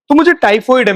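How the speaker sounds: ordinary talk, nothing marked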